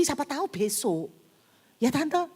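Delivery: normal talking voice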